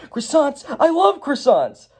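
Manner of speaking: high, thrilled, slightly drawn-out gush; excited Emmett. Acted. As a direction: squeal with joy over a pastry. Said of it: "Emmett's like".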